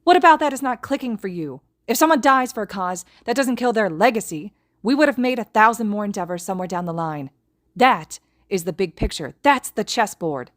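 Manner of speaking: high, loud angry tirade; shouting down the other in fury; furious yelling. A narrator's reading. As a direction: hammer each point home in rage. Izuku yelling in argument.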